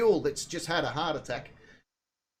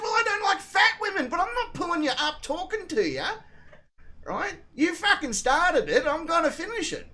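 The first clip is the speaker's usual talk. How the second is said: high-pitched argumentative comeback; retold combative retort; 11 semitones higher than usual. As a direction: hit back indignantly, voice up high.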